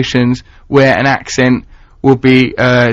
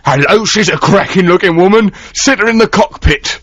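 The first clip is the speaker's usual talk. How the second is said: higher, put-on leering recruiter; mock airline recruiter; 6 semitones higher than usual. Airline boss picking a pilot by looks, mock enthusiastic high voice. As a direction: exclaim it as a hammed-up talent spotter. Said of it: "They can't just go".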